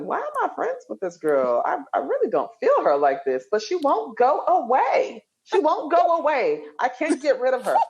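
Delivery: high, exasperated venting to husband; exasperated retelling. Retold or imitated. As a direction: she won't go away, fed up. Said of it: "I said".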